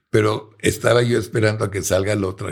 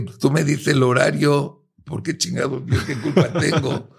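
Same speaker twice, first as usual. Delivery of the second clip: a heated, higher comeback to the shouting; indignant retort; 7 semitones higher than usual. Retold or imitated. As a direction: raise the pitch well up and fire back indignantly. Arguing back with angry client. (réplica indignada, con el tono mucho más alto)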